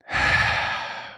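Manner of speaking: slow audible exhale through the mouth; deep calming breath. Shown as a demonstration. Showing the listener a calming breath, a deep audible sigh. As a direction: let out one long deliberate sigh. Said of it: "taking a deep breath".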